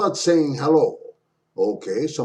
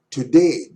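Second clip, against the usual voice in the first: "today" with "day" louder and falling; stress on day; 5 dB louder than usual. Shown as a demonstration. Teacher showing English stress, "day" stronger in "today". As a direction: punch the second syllable of "today". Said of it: "day is stronger".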